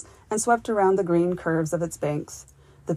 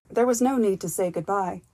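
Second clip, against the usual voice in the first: ordinary delivery, nothing marked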